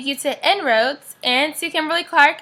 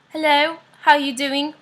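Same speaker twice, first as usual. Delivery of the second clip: playful put-on British greeting; a light, jokey British accent; pretend British accent; 3 semitones higher than usual. To viewers about London, mock British accent. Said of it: "my pretend British accent".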